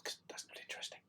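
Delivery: a dry whispered aside, barely voiced. Real, not acted. Vlogger whispering a dry joke to viewers. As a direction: breathe it out like a joke to the camera. Joking aside after long pause.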